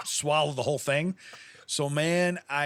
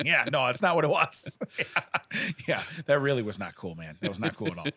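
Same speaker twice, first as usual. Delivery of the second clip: ordinary talk, nothing marked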